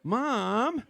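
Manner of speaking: high, drawn-out call of Mom. Real, not acted. Speaker calls on Mom mid-demonstration, high drawn-out call. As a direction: stretch it out in a high, swooping call.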